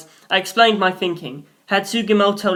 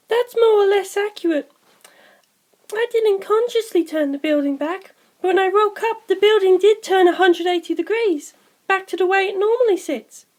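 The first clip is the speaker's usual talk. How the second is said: put-on much higher young woman's voice, gentle; Sugimoto's voice; 14 semitones higher than usual. A narrator's reading.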